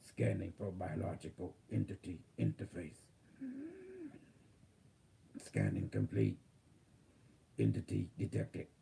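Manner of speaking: calm robotic system readout. Acted. Channels AI from future.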